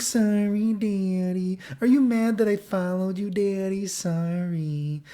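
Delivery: put-on high, long drawn-out baby-doll apology; imitated drunk girl. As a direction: stretch each word in a girly singsong.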